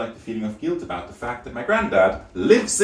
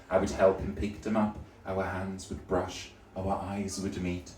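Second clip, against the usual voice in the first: dreamy romance; 2 semitones lower, 7 dB quieter than usual. Retold or imitated. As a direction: breathe it like a romance novel. Romantic daydream.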